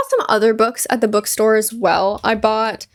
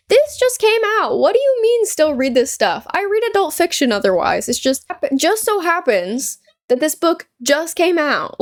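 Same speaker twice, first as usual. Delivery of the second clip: indignant defence; 6 semitones higher than usual. Retold or imitated. Defending her book to coworker.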